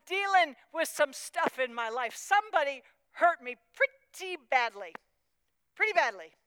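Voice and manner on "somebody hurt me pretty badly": usual speaking voice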